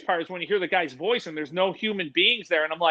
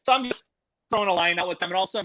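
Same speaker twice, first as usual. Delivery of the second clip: ordinary delivery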